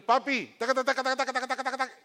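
put-on high, rapid-fire child chatter; little kids pestering. Little kids running to dad at the television, high chattering. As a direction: rattle on like kids nagging papi. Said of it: "vienen los muchachitos".

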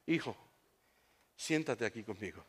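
soft, low, tender invitation; calm father. Acted. Father to his locked-in son, calm and gentle. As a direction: settle the child down gently.

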